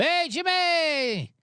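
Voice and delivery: high, drawn-out call across the street; stretched, high hey Jimmy shout; yelled greeting. Retold or imitated. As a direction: call out to him from outside the bar. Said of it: "And I yelled at him".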